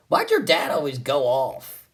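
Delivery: normal talking voice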